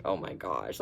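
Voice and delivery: low groan. Retold or imitated. Freaking out all day.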